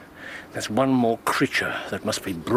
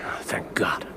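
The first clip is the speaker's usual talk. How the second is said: whispering; 5 semitones lower, 5 dB quieter than usual. Acted.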